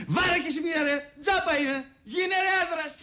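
retold tough yell. Security man urging the crying boy to hit, mock shout. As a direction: bark it like a rough cop egging on a boy. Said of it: "και μου λέει".

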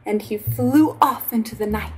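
ordinary delivery, nothing marked

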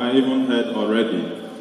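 usual speaking voice

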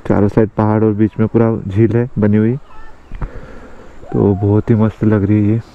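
usual speaking voice

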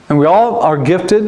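ordinary talk

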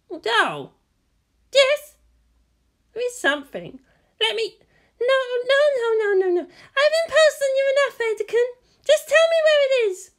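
put-on high, eager young woman; bright high pitch, brushing him off; high woman's voice. A narrator's reading.

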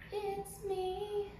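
high, eerie alien voice, lightly done; creepy alien voice. Retold or imitated. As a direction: imitate a creepy alien saying it.

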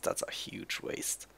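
breathy whispered aside. Real, not acted. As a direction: whisper it under your breath. Muttering about wasted card.

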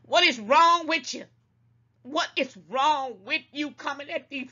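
loud, high, fed-up demand; a sharp rebuke to the chat; exasperated scolding. Real, not acted. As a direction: call them out, exasperated. Scolding her viewers.

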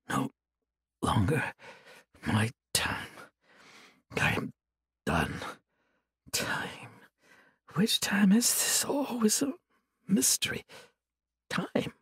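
faint, lost whispering; delirious whisper. Acted. Chained monk whispering deliriously to himself, faint. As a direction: drift in and out of sense.

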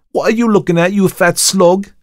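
high, loud Cockney taunt; angry Cockney challenge. A narrator's reading. Thomas challenges the watchman, in loud indignant Cockney. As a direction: call it out, drawing out the insult.

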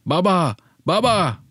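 high, urgent cry out to a father; acted shout. A narrator's reading. Child calls father to the nest, acted high-pitched shout. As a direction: call out high, as a child yelling for dad. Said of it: "Avazım çıktığı kadar".

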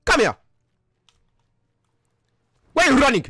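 put-on high, agitated African accent; indignant outburst in a put-on African accent. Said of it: "so you don't have an African accent".

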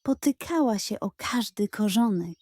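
plain reading voice

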